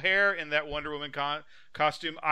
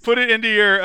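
higher, louder bubbly instruction; chirpy cooking host; 8 semitones higher, 11 dB louder than usual. Retold or imitated. Cooking video host instructing viewers, loud chirpy mock. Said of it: "she goes".

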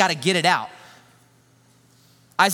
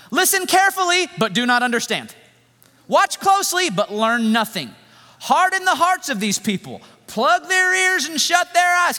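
sarcastic God; 10 semitones higher, 3 dB louder than usual. Acted. God sarcastically instructs Isaiah, high mocking sing-song. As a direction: sneer each command at a prophet you know will fail. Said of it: "Can you hear the sarcasm?".

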